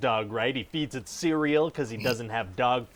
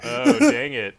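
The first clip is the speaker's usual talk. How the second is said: higher, louder oh dang it; surprised dismay; 8 semitones higher, 6 dB louder, slower than usual. Acted. Executive realizing he misprinted a name, surprised. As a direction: blurt it out, caught off guard.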